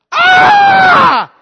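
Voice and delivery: put-on high, loud shriek of little girls; a sharp, high, loud girlish scream of disgust. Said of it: "the little sisters are like".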